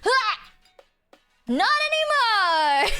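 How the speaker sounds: loud, high gotcha; triumphant. Real, not acted. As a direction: gloat it slowly. Triumphant retort.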